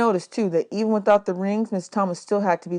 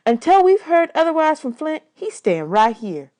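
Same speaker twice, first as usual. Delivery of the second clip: firm woman; 8 semitones higher, 4 dB louder than usual. A narrator's reading. Miss Thomas insists firmly.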